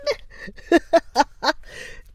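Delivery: high, bright burst of laughter; delighted laugh. Real, not acted. Laughing at the viewer's joke.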